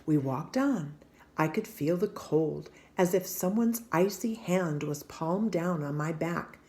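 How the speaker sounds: ordinary delivery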